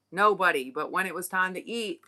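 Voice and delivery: normal talking voice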